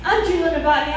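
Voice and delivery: normal talking voice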